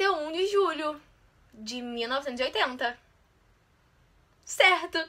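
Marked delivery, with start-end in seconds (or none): 4.4-5.1 happy cheer. Real, not acted. Got the answer right.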